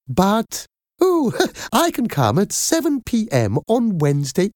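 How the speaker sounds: high, happy, gushing nanny voice; Madam Doubtfire. Acted. Nanny arranging an interview, happy and gushing. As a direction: chatter cheerfully in a lifted, effusive character voice.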